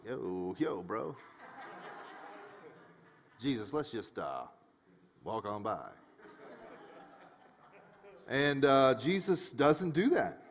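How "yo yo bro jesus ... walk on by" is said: low, hushed uneasy aside; uneasy disciple. Acted. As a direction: murmur it discreetly, wanting to slip away. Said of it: "Imagine if you're a disciple watching this".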